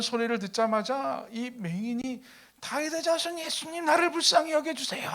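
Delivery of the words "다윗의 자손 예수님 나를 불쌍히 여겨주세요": high-pitched imitated desperate call; crying out. Blind man calling to Jesus for mercy, high pleading cry. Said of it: "큰 소리로 외칩니다".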